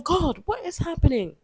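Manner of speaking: high, exasperated appeal without real yelling; mock shouting. Retelling her complaints to God, mock shouting. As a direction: act out a high-pitched protest to God. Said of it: "I'm shouting. I'm like".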